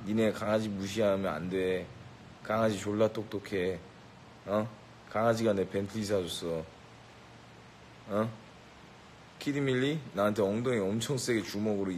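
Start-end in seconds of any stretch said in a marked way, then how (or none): none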